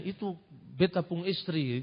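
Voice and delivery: soft, quiet Ambonese Malay "beta pung istri"; a hint of Ambonese accent, said quietly. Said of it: "golongan Nyambon, Saparua, ngomong".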